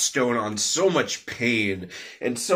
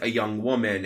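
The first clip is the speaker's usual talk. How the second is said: ordinary delivery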